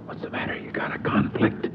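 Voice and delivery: hushed teasing whisper; whispered joke. Retold or imitated. Howard teasing the narrator in the pew, whispered joke. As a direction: tease him under your breath.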